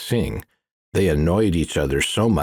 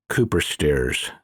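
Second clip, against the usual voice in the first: usual speaking voice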